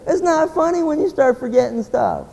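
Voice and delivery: high, put-on complaining voice; hammed-up disapproval, pitched high; mock offended listener. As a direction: mimic someone who can't take the joke. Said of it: "But see, it's like".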